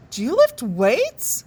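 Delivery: high, slow sweet coo. A narrator's reading. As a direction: coo it admiringly like a smitten girl. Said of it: "she cooed".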